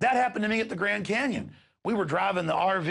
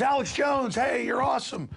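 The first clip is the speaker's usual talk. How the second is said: excited fan shout; 8 semitones higher than usual. Retold or imitated. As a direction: yell it out the car window like a thrilled listener. Fan calling out from car.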